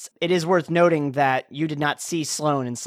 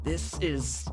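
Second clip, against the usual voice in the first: slow, quiet, straining under a mind hold; strained; 7 dB quieter, much slower than usual. Acted. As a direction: push each word out against a psychic grip. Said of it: "he's also straining".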